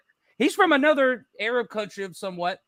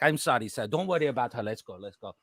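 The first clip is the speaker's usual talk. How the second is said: timid quiet apology; 10 semitones lower, 7 dB quieter than usual. Retold or imitated.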